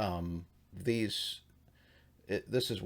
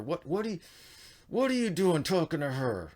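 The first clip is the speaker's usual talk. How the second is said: higher, louder jealous challenge; angry confrontation; 8 semitones higher, 4 dB louder than usual. Retold or imitated. Canaanite confronts Sethite, angry high challenge. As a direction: demand an answer, bristling.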